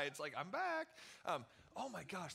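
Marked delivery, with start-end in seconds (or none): 0.4-0.9 high, breathy spooky horror-movie tease; horror movie villain. Retold or imitated. Youth pastor teasing students with a horror-movie voice. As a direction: tease the freshmen like a creepy movie villain returning. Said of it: "that scary horror movie intro".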